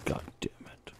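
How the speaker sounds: soft unvoiced whisper. Real, not acted. Streamer muttering to viewers while sneaking, whispered. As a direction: keep it hushed so nothing hears.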